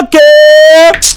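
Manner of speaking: long, held, high okay; drawn-out reaction. Retold or imitated. Replying to the neighbor in a story, drawn-out okay. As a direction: stretch okay out in mock realization.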